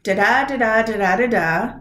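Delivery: four da-DA beats, each second "da" stressed; iambic beat. Shown as a demonstration. Teacher sounding out Dickinson's poetry for students, in sing-song iambic beats. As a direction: chant "da-da" four times, weak then strong. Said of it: "her poetry sounds like".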